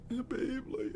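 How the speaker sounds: mock crying, very high and soft; squeaky put-on sobby voice; tearful girlfriend. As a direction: imitate a weepy girlfriend on the phone. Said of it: "Why are you crying?".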